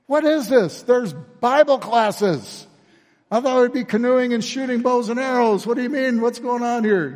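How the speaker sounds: high, loud indignant outburst; mad camper. Retold or imitated. Boy at camp protesting Bible classes to his friend, high angry shout. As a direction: blast it like a cheated kid who wanted canoeing. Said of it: "You know, he was mad".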